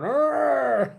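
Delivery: high, loud hammed-up battle roar; mock war cry. Comedian imitating Washington charging the Germans, mock roar. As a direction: bellow it like a general charging with a sword. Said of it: "he says".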